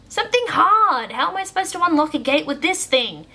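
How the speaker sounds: high, loud indignant complaint; frustrated shout at a useless helper; exasperated. A narrator's reading. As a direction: raise your voice in disbelief. Exasperated at being handed toy.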